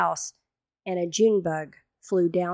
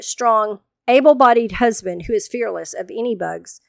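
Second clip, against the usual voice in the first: ordinary delivery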